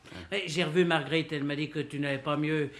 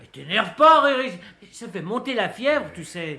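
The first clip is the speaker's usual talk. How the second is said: raised calming; 3 semitones higher, 8 dB louder than usual. Acted. Calming the shouting man.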